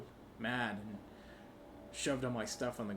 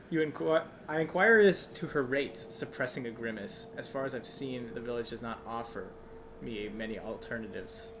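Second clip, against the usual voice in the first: usual speaking voice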